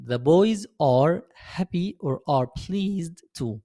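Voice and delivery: slow, word-by-word English for beginners; teacher reading an English example very slowly; very slow model sentence. Shown as a demonstration. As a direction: drag every word out so students can copy. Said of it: "هنقولها إزاي؟".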